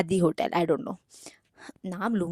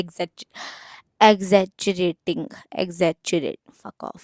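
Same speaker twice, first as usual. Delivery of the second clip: normal talking voice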